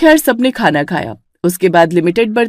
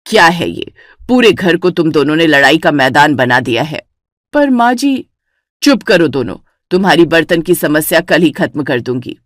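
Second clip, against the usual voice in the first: put-on lower, stern old mother-in-law; a lower, annoyed elder's voice; 4 semitones lower than usual. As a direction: scold the bickering daughters-in-law. Mother-in-law scolds both.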